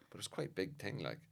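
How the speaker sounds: usual speaking voice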